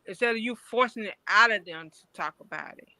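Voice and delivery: normal talking voice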